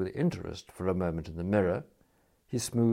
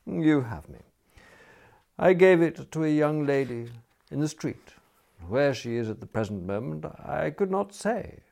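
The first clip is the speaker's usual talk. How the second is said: lifted, measured confession with mild regret; touch of manly regret; 7 semitones higher, 3 dB louder than usual. A narrator's reading. Smith admits giving the umbrella away, mildly regretful. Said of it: "touch of manly regret in his voice".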